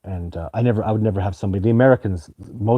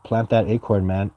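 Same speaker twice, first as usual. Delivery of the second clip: ordinary talk, nothing marked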